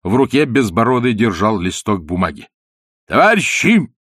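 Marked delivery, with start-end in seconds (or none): 3.1-3.9 high, loud cross cry to the crowd; angry shout. A narrator's reading. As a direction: bark it out like an angry official. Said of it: "сердито крикнул он".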